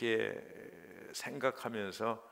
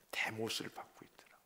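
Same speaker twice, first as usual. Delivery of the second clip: soft unvoiced whisper; hushed dramatic whisper; 5 dB quieter than usual. Real, not acted. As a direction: drop to a breath for the hard truth. Sermon climax, whispered.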